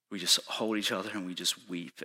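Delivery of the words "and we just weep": ordinary delivery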